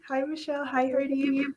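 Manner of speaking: ordinary delivery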